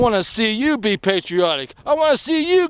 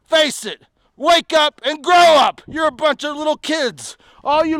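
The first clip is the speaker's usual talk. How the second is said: angry shout; 6 semitones higher, 4 dB louder than usual. Real, not acted. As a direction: bellow it at the whole audience. Ranting shout at liberals.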